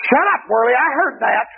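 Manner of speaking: high, sneering demon voice. Retold or imitated. Demon snapping at the praying preacher, high and sneering. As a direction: snap it like a mocking demon. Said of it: "that demon just happened to look over and look at me and said".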